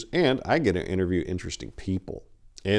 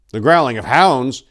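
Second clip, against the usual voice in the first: sudden loud cry of alarm, pitched up; alarmed shout; 6 semitones higher, 10 dB louder than usual. Acted. Tor waking to the attack, alarmed shout. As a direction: snap awake and shout the warning.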